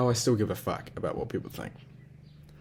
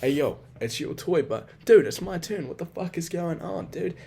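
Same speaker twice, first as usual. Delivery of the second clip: higher-pitched, incredulous protest; acted indignant comeback; 8 semitones higher than usual. Standing up to a kid over a toy car, acted indignant protest. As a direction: argue your turn, mock-outraged. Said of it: "I'd be like".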